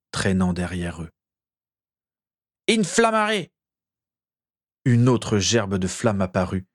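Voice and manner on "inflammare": forceful spell. A narrator's reading. Feverish wizard casting "Inflammare" again, high and forceful. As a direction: fling out "Inflammare" with all your strength. Spell cast with more power.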